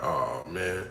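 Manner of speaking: quiet, deflated sigh of a reply; deflated groan. Retold or imitated. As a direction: sag into a weary "oh man". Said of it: "I'm like".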